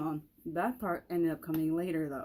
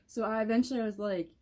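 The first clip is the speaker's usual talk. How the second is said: usual speaking voice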